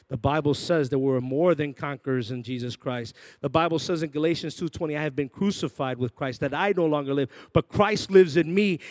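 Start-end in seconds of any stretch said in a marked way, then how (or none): 3.4-8.8 rising, louder preaching; preaching Galatians with growing force. Acted. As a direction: build the scripture to a shout. Quotes scripture at full force.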